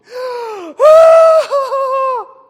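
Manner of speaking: a loud, high crying gasp; loud sobbing gasp. Retold or imitated. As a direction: gasp out like someone breaking into sobs. Imitating the sobbing girl.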